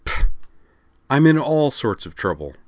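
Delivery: scoff, then complain bitterly. A narrator's reading. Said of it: "she said bitterly".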